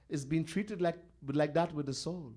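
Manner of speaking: ordinary talk, nothing marked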